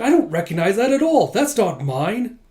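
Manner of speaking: high, loud protest; acted-out defensive panic; alarmed denial. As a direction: deny it with rattled insistence. Retold denial of planted files.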